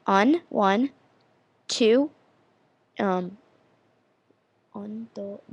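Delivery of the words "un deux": hushed quick recall; counting to oneself. Real, not acted. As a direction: count softly under your breath. Counting up to find the word.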